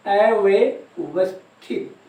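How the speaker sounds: अव्यवस्थित drawn out very slowly, in separate pieces; slow dictation. Shown as a demonstration. Repeats word slowly for note-taking students.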